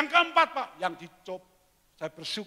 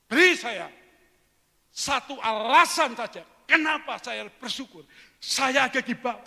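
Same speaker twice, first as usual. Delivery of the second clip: higher, louder furious demand; angry demand; 7 semitones higher, 9 dB louder than usual. Retold or imitated. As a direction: demand one reason, enraged. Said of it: "pengusahanya ngamuk, emosi". (tuntutan yang marah)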